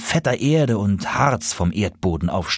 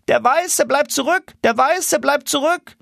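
high calling goose voice, alarmed. Acted. Rear geese reporting the lagging gander, high call. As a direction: shout the news forward.

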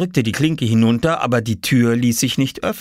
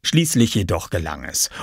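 ordinary delivery

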